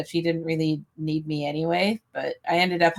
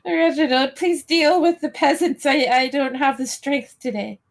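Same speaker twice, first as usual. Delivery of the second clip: imperious plummy grande dame; 8 semitones higher than usual. Pretend. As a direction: sigh orders at the servant. Grand lady ordering butler.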